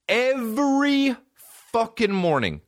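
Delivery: "Every" very high and drawn out; exasperated emphasis. Real, not acted. Complaining of mom's daily wake-up, high stretched "Every".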